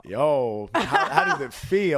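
loud, slow, laughing tease; loud teasing cackle-laugh, slow; laughing taunt. Real, not acted. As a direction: needle her through laughter. Teasing friend over the question.

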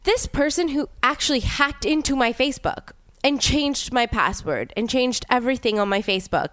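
ordinary talk

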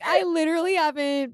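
high, pleading denial; indignant protest. Retold or imitated. Answering her date, high indignant denial. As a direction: protest that it isn't true, wide-eyed. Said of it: "I'm like".

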